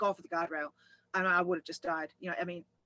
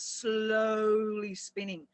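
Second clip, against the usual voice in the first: drawn-out slow; 2 semitones higher, much slower than usual. Real, not acted. Recounting the car spinning, drawn out slowly. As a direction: stretch "slowly" right out.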